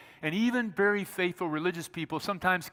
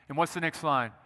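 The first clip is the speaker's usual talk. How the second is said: usual speaking voice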